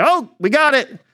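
high, airy mock confidence; breezy overconfidence. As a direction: chirp it like you've got it all handled. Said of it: "the disciples say".

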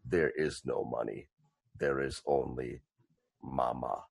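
deep mafia-style threat from a giant; hushed, deep warning with weapon on the table; menacing brute voice. Acted. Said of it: "puts his great club on the table and goes".

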